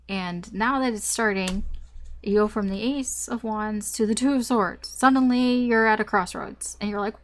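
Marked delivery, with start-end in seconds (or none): none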